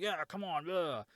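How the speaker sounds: half-hearted imitation of calling something over; mock calling out. Ron calls for the shark, mock calling out. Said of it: "calling for the shark".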